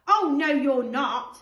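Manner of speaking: mock-outraged kid refusing; indignant refusal. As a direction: shut them out, loud and firm. George refusing the twins.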